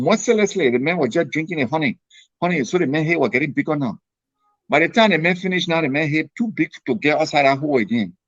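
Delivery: ordinary talk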